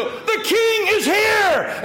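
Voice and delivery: high, drawn-out slow proclamation; jubilant shout. Acted. Gathered people hailing the king's arrival, jubilant shout. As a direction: shout it out as a royal announcement. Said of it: "and we shout".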